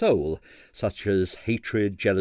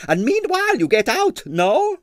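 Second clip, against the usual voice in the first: high, brisk impresario dismissal; impresario shooing off; 4 dB louder than usual. Acted. Impresario ending the talk with Stanley, high and brisk. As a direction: send him off, done with it. Stroganoff in character voice.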